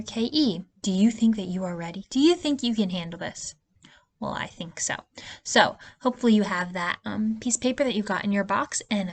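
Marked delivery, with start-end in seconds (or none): 4.2-4.9 whisper. Acted. Teacher answers own question softly.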